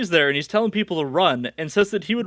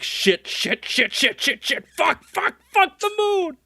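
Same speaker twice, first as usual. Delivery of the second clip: rapid swearing climbing higher to a drawn-out end; acted mounting panic; 10 semitones higher, slower than usual. Friends seeing Goku transform, acted panic. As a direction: build from muttered alarm to high mock panic.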